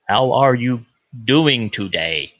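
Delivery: slow, put-on American accent. Pretending he has become an American, put-on American accent. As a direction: draw it out in a hammed-up American accent. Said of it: "start talking like an American".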